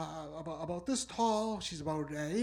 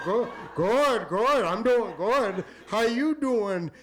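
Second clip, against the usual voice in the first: loud, drawn-out mock charmer; gushing reply; 5 dB louder, slower than usual. Young scooper flattering an elderly customer, gushing. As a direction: lay the greeting on thick.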